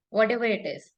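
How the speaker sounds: ordinary talk, nothing marked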